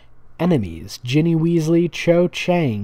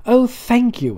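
much higher and louder, a hammed-up schoolgirl gush; gushing heroine voice; 10 semitones higher, 4 dB louder than usual. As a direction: overplay the grateful heroine. Voicing the heroine reading fan fiction.

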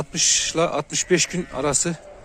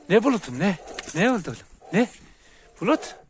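high pet-talk coo; 6 semitones higher than usual. Real, not acted. Owner asking the second dog what's wrong in high baby talk.

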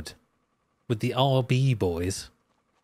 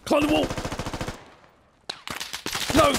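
excited shout; faster than usual. Real, not acted. Urging squad leader in game.

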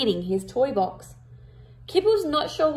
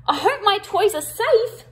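Kipper voice; 9 semitones higher, 4 dB louder than usual. Acted. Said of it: "said Kipper".

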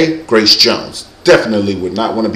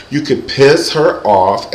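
normal talking voice

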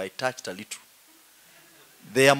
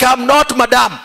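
laughing protest; 11 semitones higher, 13 dB louder than usual. Retold or imitated. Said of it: "You're like".

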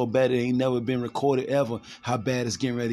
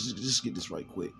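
dropped low and soft, to himself; muttered aside; 6 semitones lower, 10 dB quieter than usual. Real, not acted. Preacher to himself, looking up a verse, quiet aside. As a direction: mumble it under your breath. Aside while finding the passage.

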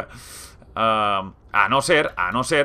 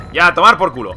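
loud, higher victory yell; triumphant shout; 5 semitones higher, 9 dB louder than usual. Real, not acted. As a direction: yell in relief at landing. Finally landed.

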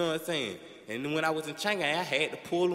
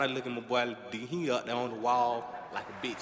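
ordinary talk

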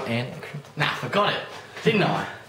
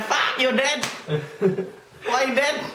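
broad Ocker Australian voice; lightly put-on Australian bloke; Australian accent; 8 semitones higher than usual. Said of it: "that's not even the accent".

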